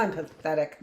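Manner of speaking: usual speaking voice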